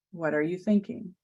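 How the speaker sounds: usual speaking voice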